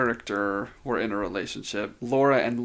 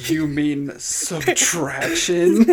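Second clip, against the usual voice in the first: slow, loud, higher mock disbelief; shocked and disgusted; 4 semitones higher, 7 dB louder, slower than usual. Friend correcting a player's reverse math, shocked and disgusted. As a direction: draw it out, appalled at the stupidity. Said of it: "equal parts shocked and disgusted".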